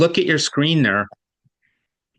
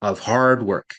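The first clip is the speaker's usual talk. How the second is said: "hard" and "work" both weighted, pitched low; stress on hard work; 4 semitones lower than usual. Shown as a demonstration. Teacher models the phrase to copy, stressing "hard work". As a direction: give "hard work" two heavy beats. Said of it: "there's a strong emphasis".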